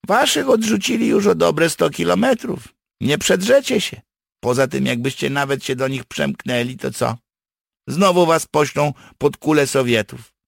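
high, loud, emphatic character voice; blunt partisan warning. Acted. Partisan tells captives they cannot get through, emphatic. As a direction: drive the hopeless facts home, pitched high.

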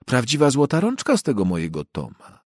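lightly voiced proud wife praising Tom; fussy housewife. Mrs Parsons speaking of Tom. (pani Parsons, lekko zagrana, dumna z męża)

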